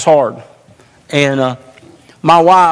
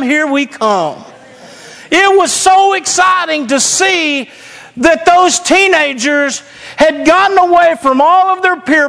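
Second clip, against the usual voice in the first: high, driving pulpit delivery; fired-up preaching; 9 semitones higher than usual. Real, not acted. Proud pastor tells congregation about his teenagers, excited preaching. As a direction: preach it with rising excitement, voice pitched up.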